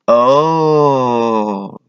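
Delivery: long drawn-out Oh of realization; dawning realization. Acted. Clerk finally grasping he is being robbed, realizing. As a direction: let the penny drop slowly.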